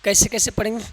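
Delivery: usual speaking voice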